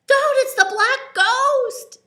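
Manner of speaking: high, loud, slow scream of terror; terrified yell. Acted. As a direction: scream a warning at the ghost. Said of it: "Danny yelled".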